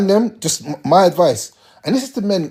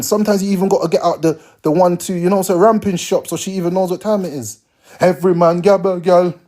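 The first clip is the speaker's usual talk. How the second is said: usual speaking voice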